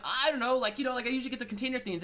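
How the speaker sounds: bubbly. Retold or imitated. Narrator bubbly and unsure ordering worms at the pet shop. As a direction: gush it out all bubbly and eager. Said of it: "And I'm all bubbly".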